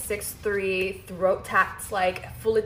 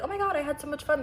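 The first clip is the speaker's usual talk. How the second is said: high-pitched mock gushing; 7 semitones higher than usual. Woman imitating herself after leaving a date, gushing. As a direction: imitate a delighted post-date rave.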